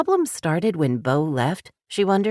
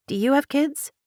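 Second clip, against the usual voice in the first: higher, tight throat; choked question; 11 semitones higher than usual. A narrator's reading. Laurel, near tears, asking Colton about kids, choked. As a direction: squeeze it out past tears. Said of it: "she managed".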